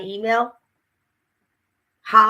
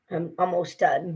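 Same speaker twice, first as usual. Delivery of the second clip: ordinary talk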